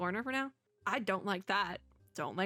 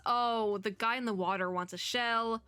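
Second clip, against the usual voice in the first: normal talking voice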